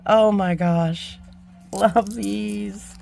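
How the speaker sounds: gasp, then slow, breathy delight; awed gasp. Real, not acted. Vlogger gasps over butterfly beads from a friend, slow awed delight. Marveling at beads in gift box.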